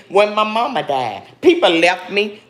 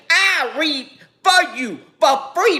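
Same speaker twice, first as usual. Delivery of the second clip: ordinary talk, nothing marked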